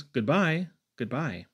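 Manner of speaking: usual speaking voice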